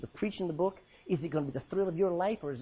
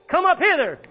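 much higher, much louder ringing call; heavenly shout; 12 semitones higher, 13 dB louder than usual. Pretend. The heavenly voice calling believers up, a ringing shout. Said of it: "they heard a voice saying".

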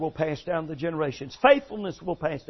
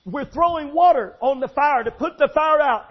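urgent calls; 6 semitones higher, 6 dB louder than usual. Retold or imitated. Family pretending to fight fire.

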